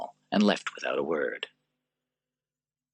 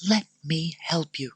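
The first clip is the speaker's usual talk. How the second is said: tender Lucilla; 11 semitones higher than usual. Acted. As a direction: recall him gently.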